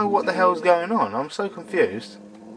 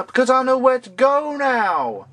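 silly high voice; 9 semitones higher than usual. Pretend. Excited silly high voice after monkey.

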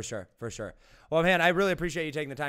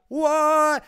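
a much higher, louder burst of surprise; surprised shout; 11 semitones higher, 7 dB louder than usual. Real, not acted. Host reacting on air to the book held up, loudly surprised. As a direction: blurt it out, caught off guard.

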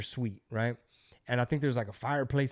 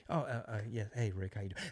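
proper executive; 3 semitones lower, 8 dB quieter than usual. Retold or imitated. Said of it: "Rick is more proper".

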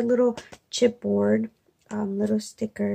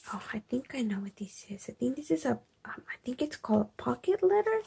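unsure musing whisper; 2 semitones higher, 6 dB quieter, faster than usual. Real, not acted. Guessing what the gift is at night, whisper. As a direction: think aloud in a hush. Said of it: "I've been a little bit quiet".

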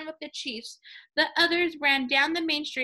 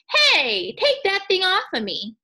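high, loud stone complaining; 6 semitones higher, 7 dB louder, slower than usual. Acted. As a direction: yell it up high like an annoyed stone.

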